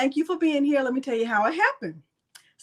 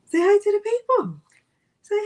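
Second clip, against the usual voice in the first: much higher, louder sing-song to the pet; high coaxing voice; 10 semitones higher, 5 dB louder than usual. Shown as a demonstration. Host to her dog Milo, high coaxing baby talk.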